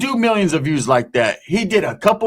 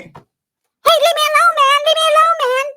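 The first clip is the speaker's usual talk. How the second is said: mock screechy protest; 4 dB louder than usual. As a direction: imitate an over-the-top whiny complainer, stretched out. Acts out hostile stopped driver.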